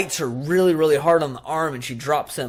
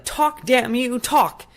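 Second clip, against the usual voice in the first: higher, louder mock furious demand; demanding yell; 11 semitones higher, 6 dB louder, much slower than usual. Karen yells at the silent doll, mock furious. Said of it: "she's like".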